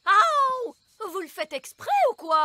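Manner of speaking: loud yelp then complaint; ouch. Acted.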